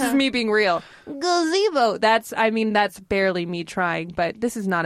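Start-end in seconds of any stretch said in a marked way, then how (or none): 1.1-2.0 put-on high old lady Gazebo. Shown as a demonstration. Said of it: "can't get into an old lady voice until I say the word gazebo".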